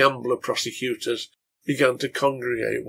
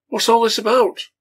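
much higher, louder call downstairs; shouted from upstairs; 11 semitones higher, 8 dB louder than usual. Acted. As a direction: shout down the stairs, alarmed. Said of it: "Frank shouted downstairs".

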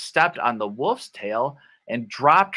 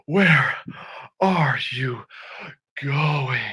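huffily; slower than usual. Shown as a demonstration. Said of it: "So huffily is like".

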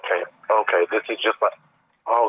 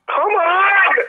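angry yell; 13 semitones higher, 4 dB louder than usual. Real, not acted. Frustrated gamer.

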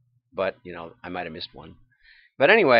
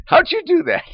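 mock-amazed caller, pitched way up; high, amazed little question; 6 dB louder than usual. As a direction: squeak it like a startled caller. Caller's reply in retold call.

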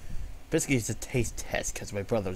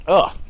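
loud grossed-out ugh; disgusted groan; 6 semitones higher, 10 dB louder than usual. Real, not acted. After tasting the shake.

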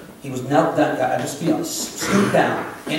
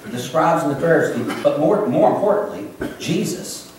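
ordinary talk, nothing marked

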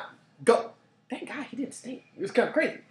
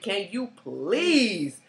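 drawn-out request; much slower than usual. Retold or imitated. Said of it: "I said".

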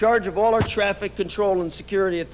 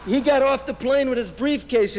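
ordinary talk, nothing marked

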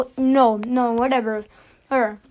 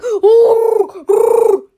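excited ooh; 5 semitones higher, 5 dB louder than usual. Real, not acted. Excited reaction to video.

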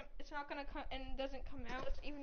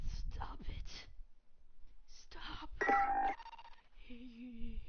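whispering; 2 semitones lower, much slower than usual. Pretend. Mock distressed whisper.

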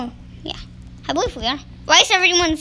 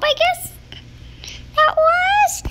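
much higher squeaky voice; 13 semitones higher, slower than usual. Real, not acted. Reacting in game.

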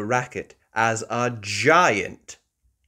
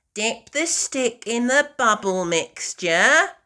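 put-on high, louder bossy little-girl voice; Peppa instructing; 11 semitones higher, 6 dB louder than usual. Peppa teaching George, high and bossy. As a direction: boss your little brother. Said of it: "Peppa tells George".

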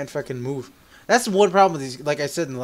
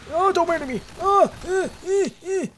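much higher pleading squeak; mock panic; slower than usual. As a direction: beg for your life in a hammed-up high voice. Pretend pleading with enemy.

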